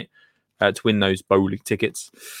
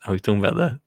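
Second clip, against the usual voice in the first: normal talking voice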